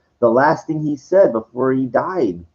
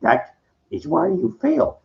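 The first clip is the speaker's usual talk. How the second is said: high, slow Yoda voice; Yoda's rebuke; 11 semitones higher than usual. Retold or imitated. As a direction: say it slow, like a wise little master. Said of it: "He goes".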